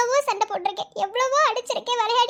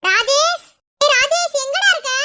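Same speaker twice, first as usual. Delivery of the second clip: loud, raised-pitch call for someone missing; 4 semitones higher, 6 dB louder than usual. Acted. Searching for missing Rajesh, calling out loudly. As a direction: call out sharply to find him.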